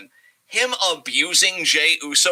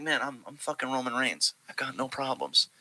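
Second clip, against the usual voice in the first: mock chill Roman; 7 dB quieter than usual. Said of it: "exudes that tranquilo energy".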